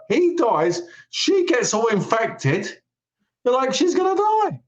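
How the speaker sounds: very high-pitched squeal of mock alarm. Viewer sees she is infected, high mock alarm. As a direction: shoot up high, like a panicking viewer.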